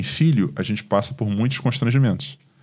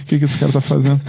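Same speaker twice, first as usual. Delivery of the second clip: retold disbelief; 6 semitones higher, 4 dB louder, faster than usual.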